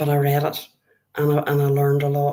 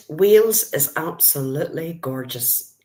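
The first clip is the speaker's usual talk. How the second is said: ordinary delivery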